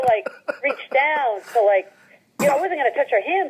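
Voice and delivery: normal talking voice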